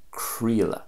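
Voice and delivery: "creala" as KREE-la; Connacht pronunciation. Shown as a demonstration. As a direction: say "creala" with an E sound in the middle. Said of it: "the E sound is quite evident".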